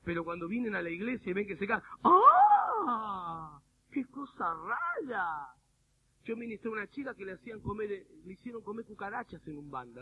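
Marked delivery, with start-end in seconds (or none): 2.0-3.6 loud, high "ah" of pretend horror; hammed-up shocked cry; mock horrified scream. Preacher mimics churchgoers horrified at falling, high mock scream. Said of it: "nadie se horrorizaba ahí". 3.9-5.5 drawn-out, slow exaggerated dismay; mock horror. Preacher mimics onlookers in church, slow mock horror.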